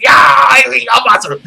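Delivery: hyped yell. Retold or imitated. Fan shouting along at the lightning kick, hyped yell. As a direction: yell it like you just became Masaru. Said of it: "I was like".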